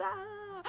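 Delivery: high, drawn-out mock scream; child's scream. Little girl screams in the monster hallway, imitated shriek. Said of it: "just screaming all in the fucking hallway".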